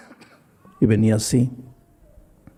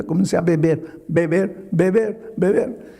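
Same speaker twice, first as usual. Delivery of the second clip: each beber pitched a bit higher; repeated beber, rising with each take; repeated, building; 4 semitones higher than usual. Retold or imitated. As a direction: hammer the word beber over and over, climbing. Preacher dramatizing the decline.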